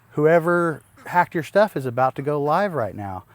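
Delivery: mock-urgent warning from his wife. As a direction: hurry the news out like an alarmed wife.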